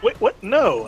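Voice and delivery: high, loud startled objection; shocked refusal. Retold or imitated. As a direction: recoil in disbelief, then refuse. Sypha reacts to the offer.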